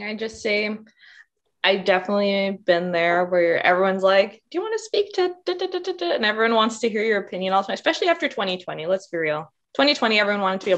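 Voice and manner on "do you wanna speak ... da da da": high sing-song mock of people asking; mock eager asker. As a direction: imitate the pushy asker, pitched way up. Mocking people asking to speak.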